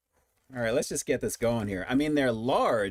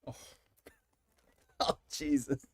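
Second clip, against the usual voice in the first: ordinary talk, nothing marked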